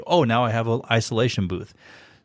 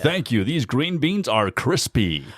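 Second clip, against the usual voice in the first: high, slow, drawn-out announcer at dinner; practised announcer voice; 5 semitones higher, slower than usual. Shown as a demonstration. Voice actor practising at Thanksgiving dinner, announcer voice. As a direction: ham up small talk like an ad read. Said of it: "Practice at Thanksgiving dinner".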